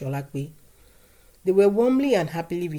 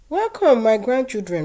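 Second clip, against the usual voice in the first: grandmother's greeting; 7 semitones higher, 8 dB louder than usual. A narrator's reading.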